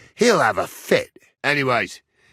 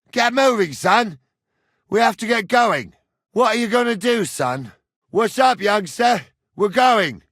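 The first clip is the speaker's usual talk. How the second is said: high, raised calling; urgent hurrying; 8 semitones higher, 4 dB louder than usual. Acted. Man telling son they have to go, urgent shouting. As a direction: chivvy the lad along loudly.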